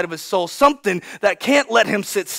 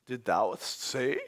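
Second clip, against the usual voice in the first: low, slow, ominous hush; disapproving patriarch, drawn out and soft; stern grandfather; 11 semitones lower, 13 dB quieter, much slower than usual. Acted. Pharaoh glares at young Moses who interrupted, low and stern. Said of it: "Grandpa's eyes furrow".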